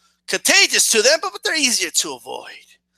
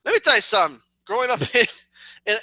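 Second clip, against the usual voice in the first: ordinary talk, nothing marked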